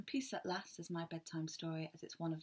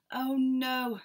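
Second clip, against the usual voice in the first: higher, louder oh no; Mister Bear woken by the dawn, louder and higher; exasperated; 8 semitones higher, 9 dB louder than usual. Acted. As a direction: groan at the morning birds. Mister Bear kept awake again.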